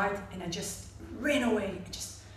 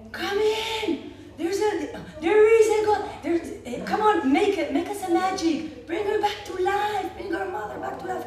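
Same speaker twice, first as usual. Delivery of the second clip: high, loud, laughing shouts to the clown; ecstatic scream; 10 semitones higher, 6 dB louder than usual. Retold or imitated. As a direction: beg loudly for a miracle.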